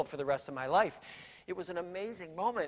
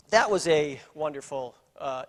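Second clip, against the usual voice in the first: ordinary delivery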